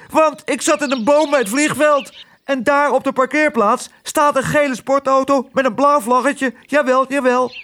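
heel hoge, opgewonden piepstem; schreeuwend musje Toep. A narrator's reading. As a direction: kwetter het nieuws hoog uit. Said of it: "schreeuwde Toep". (hoge, opgewonden mussenstem)

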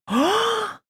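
very high delighted oh; happily surprised. A narrator's reading. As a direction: gasp with joy. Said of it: "zegt ze blij verrast". (blij verrast oh)

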